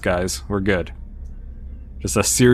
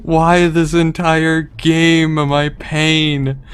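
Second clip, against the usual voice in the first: loud, very slow wail; anguished yell; 7 semitones higher, 5 dB louder, much slower than usual. Real, not acted. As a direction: stretch it out in exasperation. Despair at game.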